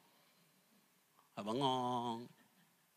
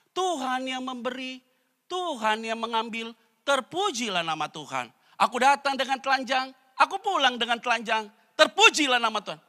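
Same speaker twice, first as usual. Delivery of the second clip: preached declaration of faith, louder at the end; Job praising God; 6 semitones higher, 6 dB louder than usual. Acted. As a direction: proclaim it like Job in his loss. Job's famous words preached.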